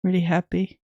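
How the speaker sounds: deadpan, unexcited "Really happy"; neutral voice. Real, not acted. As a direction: say it with no joy at all. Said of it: "The most neutral and not happy voice ever".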